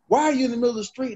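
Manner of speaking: high, fast, accusing cop voice; retold officer scolding a shaken driver, high and fast; mock officer bark. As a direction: snap it like an irritated officer demanding answers. Officer confronts him after hydroplaning.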